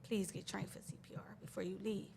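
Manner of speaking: hushed, earnest whisper; whispered plea. Retold or imitated. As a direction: whisper the nurses' warning. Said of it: "They like".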